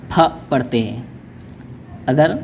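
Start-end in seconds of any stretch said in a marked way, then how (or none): 0.1-0.2 फ said as a breathy aspirated ph; teacher sounds out फ with a puff of air. Shown as a demonstration. Teacher to Urdu learners, aspirated फ clearly sounded. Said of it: "तो उसे".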